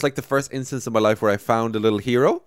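normal talking voice

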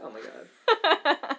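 very high, drawn-out squeal; delighted shock. Real, not acted. Friend stunned that cohost knows her order, squealing slowly. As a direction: stretch it out in high disbelief.

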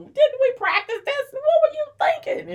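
high, loud exasperated coach's yell; sideline coach yelling. Acted. Coach yells at players during the game, exasperated. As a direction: holler it from the sideline. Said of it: "on the side screaming and yelling".

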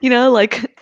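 normal talking voice

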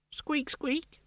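tiny high squeak, like a toy; rubbery high squeaky toy. Acted. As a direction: squeak it out as a nervous rat. Frightened rat ghost squeaking.

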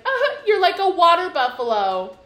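mocking laugh. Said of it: "she's like".

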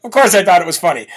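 normal talking voice

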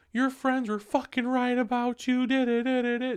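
very high mock rant; girlfriend going off. Girlfriend ranting at him, high-pitched mocking tirade. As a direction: imitate her ranting on and on. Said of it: "Just going off".

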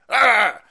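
loud hammed-up grunt of rage; a cartoon growl of temper; mock angry growl. Biden losing his temper, loud mock growl. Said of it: "he'd get angry".

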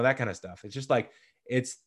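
usual speaking voice

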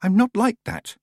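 put-on high, earnest young girl; defensive girl. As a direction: protest her innocence. Tiffany defending herself.

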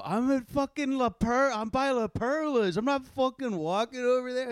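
high, whining-mad imitation; indignant refusal. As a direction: mimic a friend digging in his heels. Retelling friend refusing to walk.

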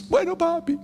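mock small child saying okay daddy; little daughter. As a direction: give in like a tearful six-year-old. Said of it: "Y llorando".

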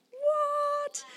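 high drawn-out disbelieving what; stunned, high-pitched reaction; astonished. Retold or imitated. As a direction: stretch it out in high-pitched amazement. Amazed at vision she retells.

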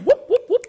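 high, loud repeated whoops; imitated whooping. Joking that sixties doctors weren't quick to diagnose, mimicked whoops. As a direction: punch out three sharp, high whoops.

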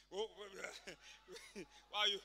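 words broken by giggles; contagious laughter breaking up the threat; helplessly laughing. Acted. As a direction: try to protest while cracking up. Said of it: "he's now laughing".

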